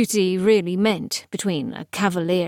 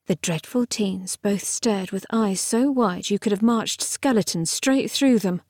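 ordinary delivery

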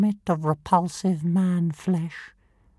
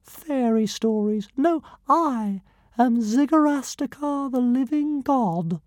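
much higher, grandly boastful little creature; pompous tiny dragon; 5 semitones higher than usual. Acted. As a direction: announce your godhood like a tiny tyrant.

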